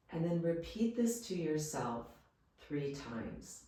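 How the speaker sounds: soft, calm Yoga Nidra guidance with long pauses; guided meditation. Real, not acted. Yoga teacher leading listeners into rest, guided meditation voice. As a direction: guide them gently, leaving space after each phrase.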